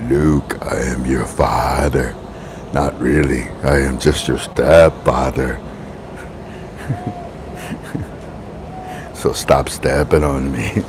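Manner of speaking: very deep, slow villain voice; Darth Vader. Pretend. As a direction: intone it like the dark lord. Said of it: "You almost sound like Darth Vader".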